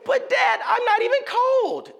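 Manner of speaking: put-on high, loud, whining little boy; whiny child protest. Son insisting to dad he's not cold, high loud whine.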